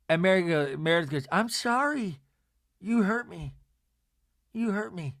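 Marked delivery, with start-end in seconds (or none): 1.3-5.1 high, mock-hurt little voice; imitated tearful hurt, high-pitched; wounded apology. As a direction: whimper it as a put-on hurt. Meredith's wounded apology, retold.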